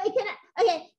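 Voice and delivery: ordinary talk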